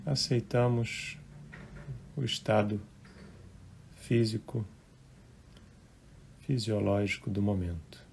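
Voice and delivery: unhurried, hushed relaxation guidance; guided meditation. Real, not acted. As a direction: gently guide listeners into relaxing, phrase by phrase.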